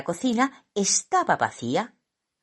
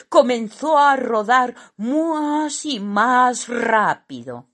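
higher, slow, stretched narration; suspenseful raised storytelling; dramatic build-up; 7 semitones higher, 4 dB louder than usual. A narrator's reading. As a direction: draw out each step as danger mounts. Narrator builds the rolling downhill.

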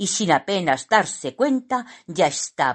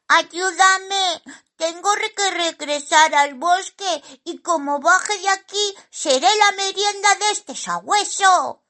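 high, squeaky plea for rescue; cartoonish high-pitched alarm; pleading little character; 14 semitones higher, 4 dB louder than usual. A narrator's reading. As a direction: beg loudly in a tiny panicked voice. Simón begs magpie for help.

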